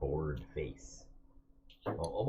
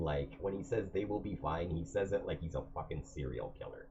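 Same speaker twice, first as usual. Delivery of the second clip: usual speaking voice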